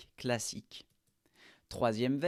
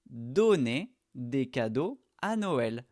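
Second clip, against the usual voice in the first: slow model sentence; 4 dB louder, slower than usual. Shown as a demonstration. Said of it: "la prononciation, c'est comme à l'infinitif".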